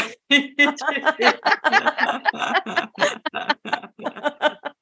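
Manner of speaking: real rolling laughter, high pitched; long high laughter. Real, not acted. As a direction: laugh along as if on a funny call. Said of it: "Cell phone laughter".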